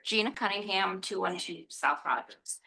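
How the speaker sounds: ordinary talk, nothing marked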